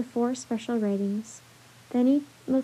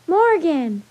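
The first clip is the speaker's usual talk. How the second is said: louder, higher happy shout of her name; joyful cry; 5 semitones higher, 9 dB louder than usual. Acted. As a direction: call out with delight at seeing her. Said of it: "Jack and Annie cried joyfully".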